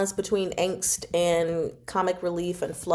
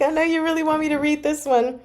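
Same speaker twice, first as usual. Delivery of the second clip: higher, put-on teasing voice; lightly voiced mock reply to a viewer; 9 semitones higher, faster than usual. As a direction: play it knowing and teasing. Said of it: "I'm like".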